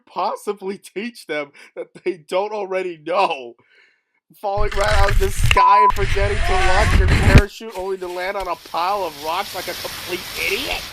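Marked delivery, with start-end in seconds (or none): 4.4-10.8 loud, scornful teasing; loud mocking. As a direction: jeer it out loudly.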